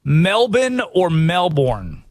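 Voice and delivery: Melbourne first as MEL-bin, then as MEL-born with the R; Melbourne said both ways, slow and deliberate; two ways to say Melbourne. Shown as a demonstration. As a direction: contrast the clipped Melbourne with the full Melbourne, slowly. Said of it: "here's the big controversy".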